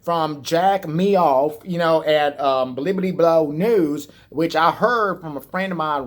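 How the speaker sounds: drawling hillbilly conspiracy talk; a hint of a rural drawl; hillbilly accent. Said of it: "And he's like".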